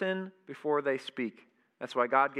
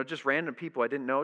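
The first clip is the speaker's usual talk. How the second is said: normal talking voice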